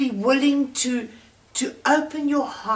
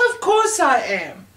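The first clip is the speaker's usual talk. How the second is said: higher, louder, impatient reply; 4 semitones higher, 5 dB louder than usual. A narrator's reading. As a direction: toss it off, irritated and loud. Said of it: "the manager answered impatiently".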